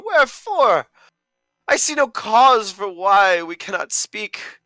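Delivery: hammed-up desperate lover crying out; over-the-top tragic shouting in verse; Johnny's anguished outburst. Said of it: "So I am Johnny".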